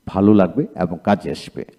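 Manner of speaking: normal talking voice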